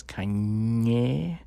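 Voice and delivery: "Kanye" with the last syllable drawn out; exaggerated, lingering "Kanye" as a demonstration; demonstrated pronunciation. Shown as a demonstration. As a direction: stretch the ending of "Kanye". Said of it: "his muscles were quite different when he says".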